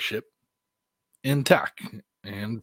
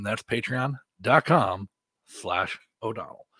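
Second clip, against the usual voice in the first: ordinary talk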